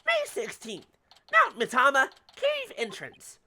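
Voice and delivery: rising, slow dramatic title read; dramatic announcement. Acted. Location card read dramatically.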